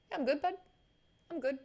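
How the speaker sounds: ordinary talk, nothing marked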